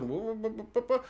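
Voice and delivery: soft, repeated teetering car sound; imitated car noise. Car on the cliff, softly imitated wobbling noise. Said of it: "And the car's like".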